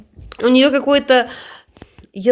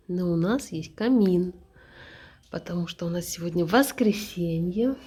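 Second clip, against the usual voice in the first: slow, hushed vlog voiceover; soft whispered narration; 5 semitones lower, 6 dB quieter than usual. Real, not acted. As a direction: speak softly by the fire. Soft quiet narration for the video.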